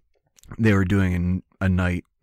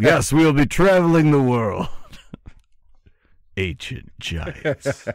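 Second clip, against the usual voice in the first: high, loud hammed-up announcer; excited trailer voice; 8 semitones higher, 4 dB louder than usual. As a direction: ham up the trailer, laughing. Mock trailer, laughing.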